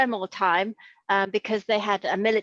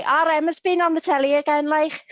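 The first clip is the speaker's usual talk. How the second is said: higher Scouse auntie voice, laughing at the end; proud Scouse auntie; 7 semitones higher, 4 dB louder than usual. Retold or imitated. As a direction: imitate a proud Liverpool aunt in broad Scouse. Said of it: "my Auntie Dorothy will say".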